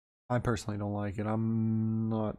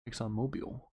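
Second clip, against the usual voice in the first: ordinary talk, nothing marked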